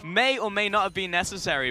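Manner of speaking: excited, fast-building shoutcast, high and loud; live play-by-play. Real, not acted. Caster calling a bottom lane fight for viewers, excited play-by-play. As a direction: call the kill chance at full force.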